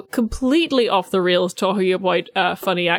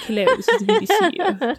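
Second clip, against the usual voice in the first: high, hammed-up BBC RP voice, laughing; mock RP; 10 semitones higher than usual. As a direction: send up a stuffy seventies BBC announcer. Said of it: "where they're like".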